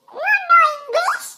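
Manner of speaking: high, very slow drawn-out question; exaggerated slow madam voice. Madam questions would-be English speaker.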